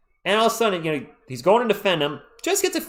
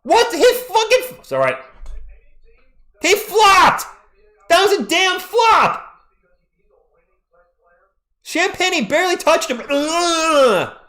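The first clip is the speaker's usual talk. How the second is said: much higher, louder outraged yelling; furious shouting; 13 semitones higher, 6 dB louder, slower than usual. Real, not acted. Streamer yells at the refs over a flop call, furious. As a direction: scream at the referee's call.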